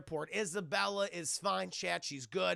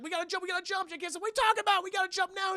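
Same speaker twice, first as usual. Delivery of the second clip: high, increasingly loud urgent babble; mock panic; 11 semitones higher, 6 dB louder, much faster than usual. Partners arguing as the bomb ticks, high panicked yelling. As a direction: urge a frantic escape, hammed-up.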